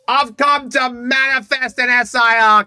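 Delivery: grand manifesting voice. Pretend. Dramatic manifest declaration.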